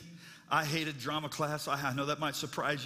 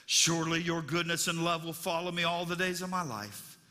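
reverent scripture reading; 2 semitones lower than usual. Acted. Preacher reads the psalm.

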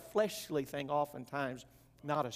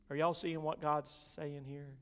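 usual speaking voice

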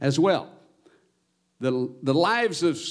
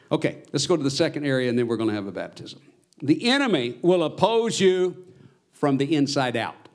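usual speaking voice

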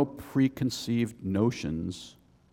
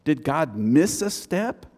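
puzzled Israelites; 4 semitones higher than usual. Retold or imitated. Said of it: "They were like".

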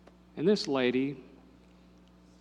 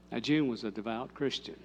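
normal talking voice

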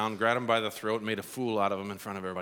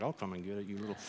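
much softer, menacing; low threat; 4 semitones lower, 10 dB quieter, faster than usual. Retold or imitated. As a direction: promise payback under your breath. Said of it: "I'm like".

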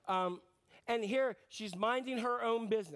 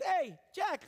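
cheery angel call; 8 semitones higher than usual. Retold or imitated. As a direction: call out to him like a chipper angel. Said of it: "Gabriel shows up and says".

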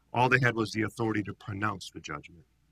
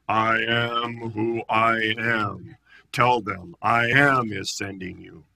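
very slow, weighty I am who I am; God answering Moses, slow and grand; booming voice of God; 4 dB louder, slower than usual. Retold or imitated. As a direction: intone it like God from the bush. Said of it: "remember Charlton Heston at the burning bush".

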